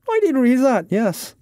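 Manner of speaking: very high character voice; Irishman, placating. Acted. Irishman admits he read the notice, placating.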